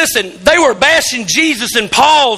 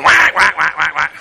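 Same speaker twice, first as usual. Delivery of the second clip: mock duck call, lower and fading; imitating duck call; 8 semitones lower than usual. Pastor recalls the duck call noise that woke him, mock call. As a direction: echo the duck call still stuck in your head. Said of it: "I woke up this morning going".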